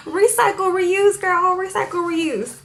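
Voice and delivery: high, bright approval; gleeful. Real, not acted. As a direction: cheer on the waitress's idea. Cheering the waitress's idea.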